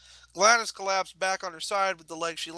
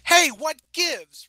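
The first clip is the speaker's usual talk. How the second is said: high, loud, drawn-out challenge; indignant proclamation; 9 semitones higher, 10 dB louder, much slower than usual. A narrator's reading. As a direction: call someone out at full voice. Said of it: "Rainbow proclaimed".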